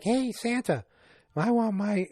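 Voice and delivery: put-on high-pitched kid calling to Santa; imitation of an eager little girl; little girl's plea. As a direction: pipe up high like a pleading child. Voices little girl asking Santa.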